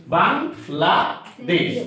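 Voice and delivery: "Bangladesh" slowly in three beats, "বাং", "লা", "দেশ"; slow, separated syllables in "Bangladesh". Shown as a demonstration. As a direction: count out the syllables of "Bangladesh" with short pauses. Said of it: "তিন ভাবে এটা উচ্চারণ করতেছি".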